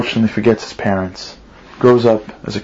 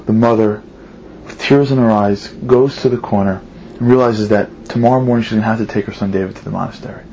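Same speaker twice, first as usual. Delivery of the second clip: ordinary delivery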